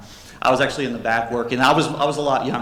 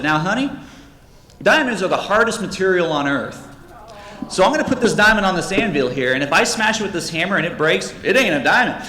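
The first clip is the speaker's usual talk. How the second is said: lightly acted husband, pitched up and louder; know-it-all husband; 6 semitones higher, 4 dB louder than usual. Husband explaining hammer test to wife, lightly voiced know-it-all. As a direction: lecture with mock confidence.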